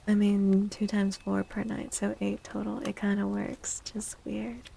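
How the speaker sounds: usual speaking voice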